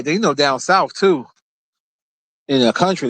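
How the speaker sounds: ordinary delivery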